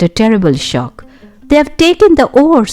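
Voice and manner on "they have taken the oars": dismay. A narrator's reading. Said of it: "said George in dismay".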